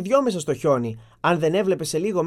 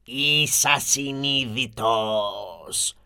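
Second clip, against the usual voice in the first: low, very slow monster growl of accusation; a beast letting each word fall slowly; terrifying monster voice; 5 semitones lower, much slower than usual. Acted. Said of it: "είπε το τέρας με φωνή τρομακτική".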